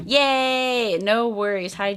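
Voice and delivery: ordinary talk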